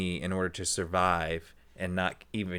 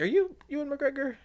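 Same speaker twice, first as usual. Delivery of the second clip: starstruck question. Retold or imitated. Fan approaching a celebrity.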